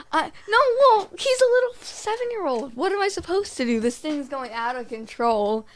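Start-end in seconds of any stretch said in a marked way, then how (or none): 0.1-3.8 very high, louder mock panic; panicked self-justifying. Explaining pushing the cousin off, high panicky defence. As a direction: squeak out a frantic excuse. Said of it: "'cause I'm".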